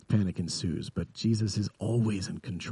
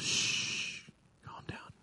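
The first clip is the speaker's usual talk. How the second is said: long shush into a faint whisper; whispered hushing; 12 dB quieter, much slower than usual. Retold or imitated. Jesus answers worried believers, whispering calm. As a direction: soothe them with barely any voice.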